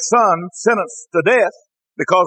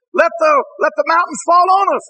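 high-pitched, preacherly cry of desperation; dramatized cry for cover; acted desperate plea; 11 semitones higher than usual. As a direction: plead like the doomed begging for cover.

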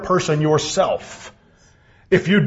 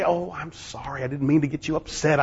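low, hushed, meek apology; soft answer; 7 semitones lower, 8 dB quieter than usual. Acted. Spouse answering a partner who yells, soft apology. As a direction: apologize softly and gently, voice dropped. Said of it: "A soft answer turneth away wrath".

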